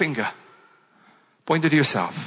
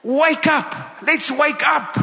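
higher, urgent wake-up cry; rousing call; 7 semitones higher, slower than usual. Shown as a demonstration. Pastor rousing the congregation after the slap, higher and urgent. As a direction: rouse the room awake. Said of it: "jouself goed geklap".

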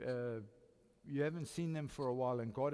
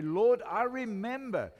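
loud, slow cry of "Lord, I remember"; fervent prayer; 7 semitones higher, 10 dB louder, slower than usual. Pretend. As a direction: call out to the Lord, slowly. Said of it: "You say".

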